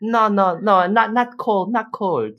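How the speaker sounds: high, hesitant broken English. Retold or imitated. Girlfriend in a date skit refusing cold noodles in high broken English. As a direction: pick each English word with effort. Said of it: "why is her English bad".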